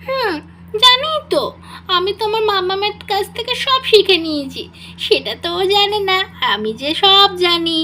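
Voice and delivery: put-on high, chirpy child voice showing off to her new tutor; a small chatterbox girl bragging, sing-song and eager; little girl voice. Little Rimi boasting to her tutor, in a child's voice.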